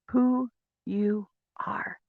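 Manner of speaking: whisper. Real, not acted. Intimate reveal to listeners.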